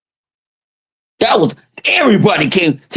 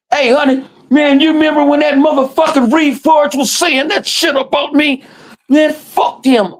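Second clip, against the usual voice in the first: voicing a friend; 11 semitones higher than usual. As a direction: play the buddy egging him on, pitched up. Voicing another person.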